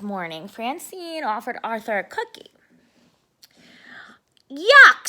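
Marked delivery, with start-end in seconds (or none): 4.5-5.1 disgusted yuck. Acted. Arthur tastes a soap cookie.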